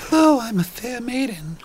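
high, dainty damsel voice; fair maiden. Pretend. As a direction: put on a high maiden greeting. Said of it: "should we mix up and start that? Try that again?".

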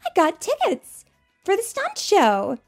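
high, loud and eager; excited Rookie. Acted. Rookie tells Sam about her tickets, high and eager. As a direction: bubble over about the tickets.

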